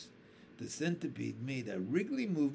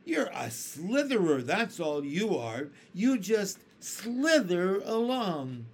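higher, louder, slow sneering insult; mocking centipede; 5 semitones higher, 5 dB louder than usual. A narrator's reading. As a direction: jeer at the worm. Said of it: "cried the centipede".

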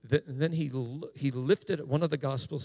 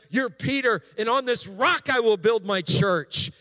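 high, loud preacher's build-up to Jesus' words; fervent preaching; 8 semitones higher, 6 dB louder than usual. Real, not acted. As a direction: lift the congregation as you voice Jesus' praise. Preaching builds to Peter's confession.

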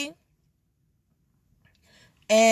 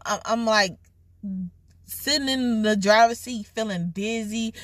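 ordinary delivery

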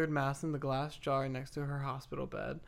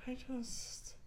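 higher, airy imitation of a friend; mocking her friend; 4 semitones higher, 4 dB quieter than usual. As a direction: tease her by copying her dreamy tone. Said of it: "you know what you sound like".

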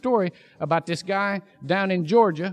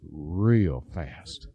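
smug low drawl; 4 dB quieter than usual. Acted. Smug driver answers kid.

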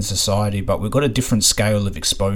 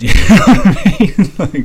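laughing; 11 semitones higher, 11 dB louder than usual. Real, not acted. Laughing after dark joke.

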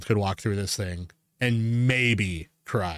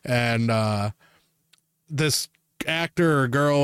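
usual speaking voice

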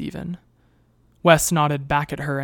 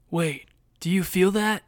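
higher, distant suspicious voice; suspicious soldier; 4 semitones higher than usual. A narrator's reading. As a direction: call it out, suddenly alert. Soldier outside senses something.

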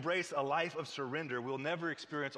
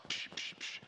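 quick whooshing mouth sound effects; voiceless "psh" for each toss; three quick voiceless whooshes; imitated throwing noises; 7 dB quieter than usual. Imitates frogs being thrown.

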